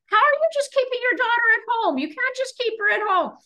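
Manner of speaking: high, fast, indignant mock rebuke; scolding caller. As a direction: imitate an outraged caller telling a mother off. Retold phone caller scolding her.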